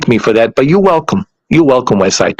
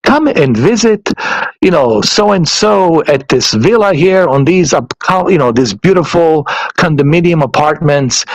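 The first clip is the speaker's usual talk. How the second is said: advertising voice; 3 semitones higher than usual. Retold or imitated. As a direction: pitch the address like a condo commercial. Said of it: "like, a advertising voice".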